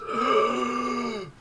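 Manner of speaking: sharp panicked gasp; gasping for air. Acted. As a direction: gasp back to life. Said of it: "He goes".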